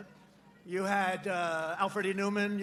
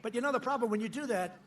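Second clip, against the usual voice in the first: ordinary talk, nothing marked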